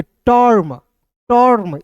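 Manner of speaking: टर्म with a long rounded vowel before r; careful model pronunciation of टर्म. Shown as a demonstration. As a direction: say टर्म drawn out as tawrm. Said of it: "इस तरीके से पढ़ते हैं".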